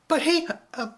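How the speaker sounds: put-on a child crying out in protest; cried out. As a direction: protest desperately. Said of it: "she cried out".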